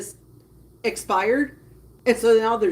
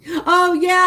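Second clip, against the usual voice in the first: ordinary delivery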